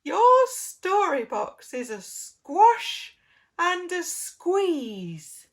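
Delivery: slow, loud and high piggy voice. Acted. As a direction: stretch it out like a squashed pig. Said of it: "The pig says".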